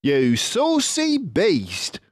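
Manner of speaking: a drawn-out, knowing Mister Fox. Acted. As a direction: clever fox leader, pleased with himself.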